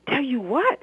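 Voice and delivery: a high, scared stammer. Acted. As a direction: stumble over the words in fear. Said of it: "he stammered".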